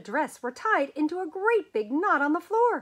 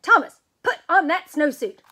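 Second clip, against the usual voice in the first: teacher yelling, much louder; exasperated shout; 4 semitones higher, 10 dB louder than usual. Acted. Teacher shouting at Thomas, losing patience. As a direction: blast the order at him. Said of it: "The teacher jumped up and down".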